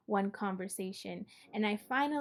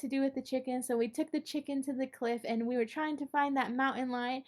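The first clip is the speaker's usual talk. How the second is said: rushed nervous confession; 3 semitones higher than usual. Retold or imitated. Frightened kid pours out everything to the uncle, fast and pleading. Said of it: "I figure if I talk really fast".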